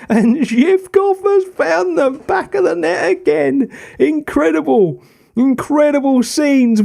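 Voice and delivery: real ecstatic goal call, slowing on the big lines; yelling he's on, pitch soaring; goal celebration shout. Real, not acted. Late winning goal in cup final.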